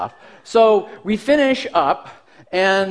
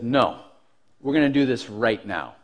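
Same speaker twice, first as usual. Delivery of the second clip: low, soft, controlled challenge; composed defiance; 7 semitones lower, 4 dB quieter than usual. Retold or imitated. Kid standing up to the bully, composed defiance. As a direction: stand your ground in a low, even voice. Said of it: "In reality, I'm sure it sounded like".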